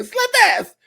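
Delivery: very high, loud squeal; slap ass sketch impression. Comedian quoting a sketch to co-hosts, high excited squeal. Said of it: "Key and Peele sketch, the slap ass".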